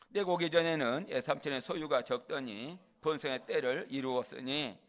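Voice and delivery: loud, emphatic scriptural declaration. Acted. Jacob talking big to his uncle Laban, loud and bold. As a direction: declare it forcefully, as a boast. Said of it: "야곱이 저 큰 소리 한번 칩니다".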